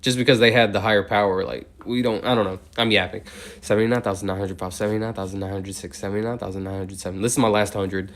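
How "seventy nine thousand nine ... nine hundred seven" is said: steady, rhythmic number chant; monotone counting. Real, not acted. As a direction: count on evenly in an unchanging tone, softer than chat. Counts aloud toward eighty thousand.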